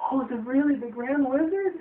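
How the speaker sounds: put-on squeaky high awed child; high little girl voice. China girl asking if he's the wizard, high awed voice. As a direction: pitch way up, wide-eyed. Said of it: "she's like".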